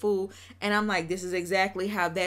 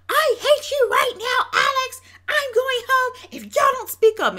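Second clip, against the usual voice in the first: high, loud mock tantrum yell; hammed-up furious shouting; imitated angry outburst; 8 dB louder than usual. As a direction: go off on them, shrieking in imitation. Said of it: "she goes off on Alex".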